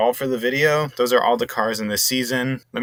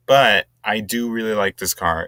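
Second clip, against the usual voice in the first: usual speaking voice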